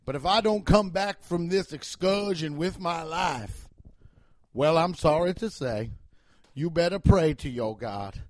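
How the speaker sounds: measured, mock-solemn Southern speech; Southern cop voice. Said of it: "I do that Southern voice".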